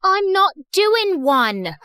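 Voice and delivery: put-on high, slow British-accented denial; stretched-out British protest. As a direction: insist indignantly in a British accent. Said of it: "stop with that British accent".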